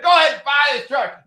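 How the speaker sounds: high, loud pushy salesman; excited urging. Retold or imitated. Car dealer pushing the red truck, excited. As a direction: sell him the truck hard.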